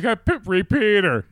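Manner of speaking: loud, high, stretched catchphrase; mock call-out. Co-host answering the cartoon in jest, mock call. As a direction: holler it slowly as a garbled catchphrase. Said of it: "and I'm like".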